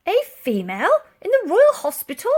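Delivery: put-on shocked old soldier.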